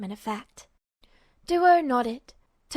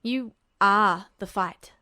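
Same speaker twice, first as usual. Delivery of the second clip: narrowed eyes; 4 semitones lower, slower than usual. A narrator's reading. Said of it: "His eyes narrowed".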